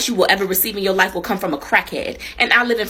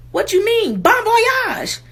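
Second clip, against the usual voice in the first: much higher, squeaking out his question; high squeaky crackhead; 12 semitones higher, 4 dB louder, much slower than usual. Retold or imitated. Crackhead asking her what bon voyage means, high squeak. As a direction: pitch it way up like a baffled stranger.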